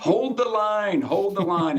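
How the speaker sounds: rally the troops like Braveheart, half joking; Braveheart rallying cry. Pretend. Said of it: "You sound like Braveheart".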